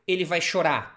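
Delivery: normal talking voice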